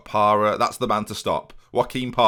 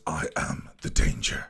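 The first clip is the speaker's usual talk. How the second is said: low whispered menace, slowed down; TV villain quote; 8 semitones lower, 6 dB quieter, slower than usual. Retold or imitated. Quoting his favourite TV show, a low slow whispered threat. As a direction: breathe the line like a cold TV villain. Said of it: "Great quote from a great T V".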